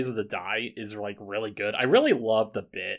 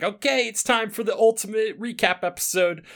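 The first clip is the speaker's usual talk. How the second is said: Monokuma; 8 semitones higher than usual. Retold or imitated. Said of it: "[name] doing the voice and just being like".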